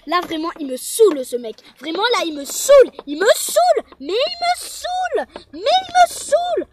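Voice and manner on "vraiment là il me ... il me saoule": rising from loud complaint to a high, loud yell; building fed-up yell. Acted. Girl shrieking about the annoying guy, building louder and higher. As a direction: get more and more fed up until you shriek.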